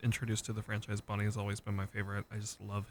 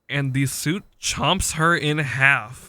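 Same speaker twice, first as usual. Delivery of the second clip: emphatic; 5 semitones higher, 12 dB louder, slower than usual. Real, not acted. Dramatic climax of retold scene.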